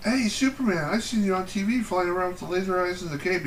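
put-on high excited kid voice; squeaky little kid gushing. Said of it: "the kid goes".

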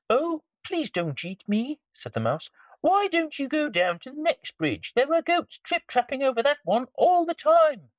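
very high little mouse; squeaky mouse voice. Acted. Mouse pleads.

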